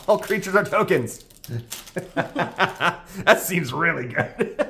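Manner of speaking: high-pitched, laughing reaction. Real, not acted. Reacting to an absurd card, laughing delight. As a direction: talk through bursts of giggles.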